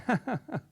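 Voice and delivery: high put-on giggle; a quick fake laugh; mock laugh. Acting out the mocking crowd, high giggle.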